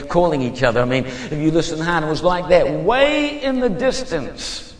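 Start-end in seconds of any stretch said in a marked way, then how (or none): 2.7-4.7 high, slow, stretched phrase; far-off effect. Retold or imitated. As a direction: draw it out high to suggest distance. Evoking a far-off sound.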